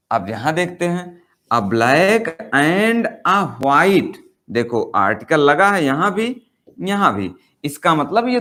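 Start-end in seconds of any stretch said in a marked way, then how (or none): 1.5-4.2 each word spelled out very slowly; slow dictation. Shown as a demonstration. Teacher reads the example to the class, very slow. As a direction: read it out slowly like dictation for students.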